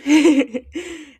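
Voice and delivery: loud, high burst of laughter; amused laugh. Real, not acted. Amused by food in definition.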